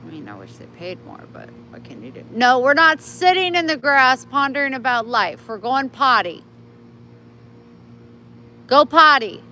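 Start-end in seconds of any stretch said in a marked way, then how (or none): 2.3-9.4 louder, higher, firm call to a dawdling dog; scolding the dog. Real, not acted. Owner telling her dog to stop sitting and go potty, exasperated. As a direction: hurry him along, exasperated.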